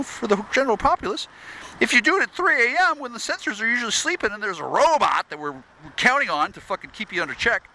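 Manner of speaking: high, mocking voice of the snooping spies; mock spy voice. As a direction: parody the government spies smugly telling him everything. Mocking what the spies would say.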